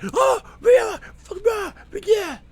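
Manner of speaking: very high, strangled road-rage yelling; mock screaming. Driver raging behind his car window, mock screaming. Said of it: "they're screaming".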